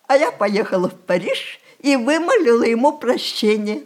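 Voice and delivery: usual speaking voice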